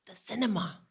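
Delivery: soft, imitated son's answer; hushed reply. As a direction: answer quietly, as the son.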